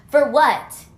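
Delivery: loud rhetorical protest; loud indignant demand. Real, not acted. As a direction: demand it with outrage.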